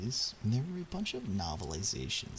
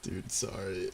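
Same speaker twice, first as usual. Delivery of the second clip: ordinary talk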